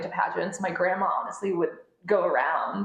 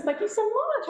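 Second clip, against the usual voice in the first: a quick higher British accent thank-you. Shown as a demonstration. Guest thanking the host in a British accent. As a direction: answer the compliment in her British accent. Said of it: "Oh, it's very good".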